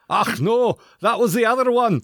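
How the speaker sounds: high-pitched, hammed-up Scottish brogue; mock Scottish accent. Co-host correcting the doorman bit, mock Scottish accent. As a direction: squawk it like a cartoon Scot. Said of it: "can you do an accent?".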